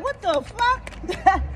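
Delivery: slow, high, drawn-out mock disbelief; a hammed-up double take; stunned what the fuck. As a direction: stretch it out like you can't believe it. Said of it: "I was like".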